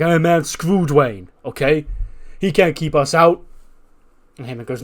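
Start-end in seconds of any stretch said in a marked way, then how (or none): 0.0-3.4 loud, pitched-up, heated protest; angry outburst. Retold or imitated. Jimmy yelling at the security guy, angry. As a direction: push back hard at security. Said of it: "And Jimmy's like".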